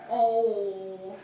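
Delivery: ordinary delivery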